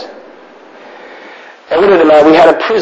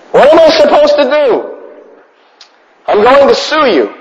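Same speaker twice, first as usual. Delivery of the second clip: mock outraged parent; 11 semitones higher than usual. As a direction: play the hysterical overprotective mother. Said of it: "Today people will be going".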